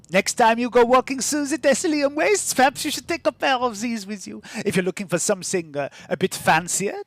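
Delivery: a showy market trader sizing up a customer; shoe-selling woman. Acted. GM voices a shoe seller.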